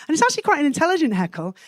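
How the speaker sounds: ordinary delivery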